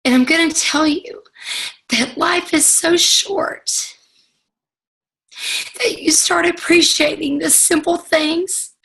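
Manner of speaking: fighting tears while thinking of her dad; choked up. Real, not acted. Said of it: "I'm sorry".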